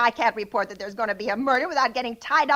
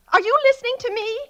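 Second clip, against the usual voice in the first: higher, sharper indignant question; indignant demand; 7 semitones higher, 3 dB louder, faster than usual. Acted. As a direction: snap at him to pay attention. Caller snapping at distracted sergeant.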